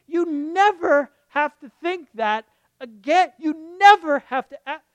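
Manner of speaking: loud, high preaching with punched words; fervent preacher's outburst; impassioned proclamation. Real, not acted. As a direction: drive it home at full force. Preacher proclaims release with full force.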